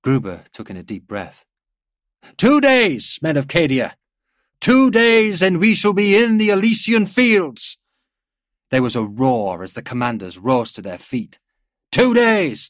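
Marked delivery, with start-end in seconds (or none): 2.4-7.8 high, full-voiced battlefield shout; rallying cry. A narrator's reading. General rousing his men. 11.9-12.7 loud, high rallying shout; crowd shouting back. A narrator's reading. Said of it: "they shouted back".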